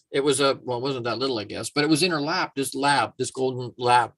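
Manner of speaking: usual speaking voice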